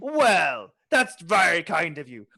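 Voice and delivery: pirate voice slipping into Irish; hearty pirate with an Irish lilt. Acted. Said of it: "my pirate voice is slipping through into Irish".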